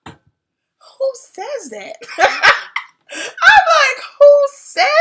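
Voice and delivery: high, gasping mock outrage; gasp, then squeal it in disbelief; laughing, high-pitched incredulity; shocked disbelief. Retells shock at being cursed at.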